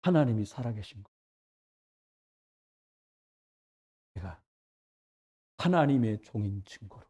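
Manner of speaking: low, hushed prayer with long pauses; quiet, unshouted prayer. Acted. Elijah prays briefly to God before the crowd, quiet low prayer. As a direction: pray softly and very low, no need to shout. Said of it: "기도를 합니다. 길게도 안 해. 소리도 안 질릴".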